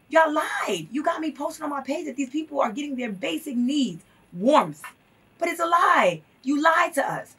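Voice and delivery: retold accusation, pitched high with loud bursts; emotional outrage. Activist recalling her reaction to officials' false promises, emotional outrage. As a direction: re-enact feeling betrayed, hitting hard on the lies. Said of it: "I got so emotional".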